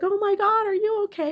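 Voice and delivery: high-pitched imitated concern; retold alarm. Couple coming out to the fallen woman, imitated high-pitched concern. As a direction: mimic a worried passer-by, voice lifted high.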